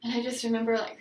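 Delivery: ordinary talk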